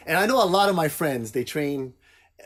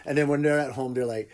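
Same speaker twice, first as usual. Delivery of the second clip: ordinary talk, nothing marked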